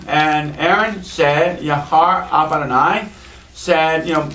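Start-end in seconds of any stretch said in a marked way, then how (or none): none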